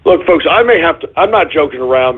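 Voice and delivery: normal talking voice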